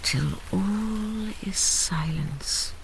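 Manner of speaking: soft whispered close, slowing at the end; hushed narration. A narrator's reading. The narrator ends the tale in a whisper. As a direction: let the story fade to silence.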